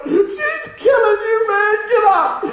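high, loud, drawn-out imitated yell; frantic mock shout. Buddy yelling as his friend loses a fight, hammed-up frantic shout. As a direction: holler it in hammed-up alarm.

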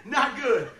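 ordinary talk

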